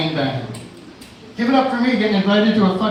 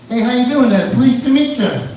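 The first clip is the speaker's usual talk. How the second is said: friendly cop greeting; 5 semitones higher, 4 dB louder than usual. Acted. Undercover cop greeting, acted.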